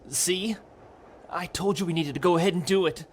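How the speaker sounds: put-on high-pitched, impassioned young lover; urgent pleading. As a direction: plead your case, high and insistent.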